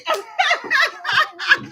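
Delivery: big high burst of laughter. Real, not acted. Laughing at own joke.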